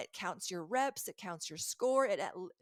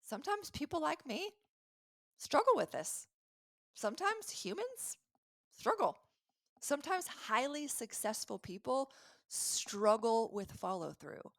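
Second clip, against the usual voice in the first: gentle reassurance; 5 semitones higher than usual. Shown as a demonstration. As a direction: talk yourself down kindly, lifting each line. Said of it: "a way that I could neutralize it is".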